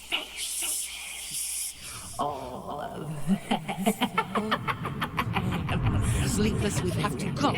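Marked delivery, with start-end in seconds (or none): 2.2-7.5 loud, high, desperate plea; frantic pleading. Acted. Friend begging Sleepless to take her hand and fly, desperate. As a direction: beg them to run now.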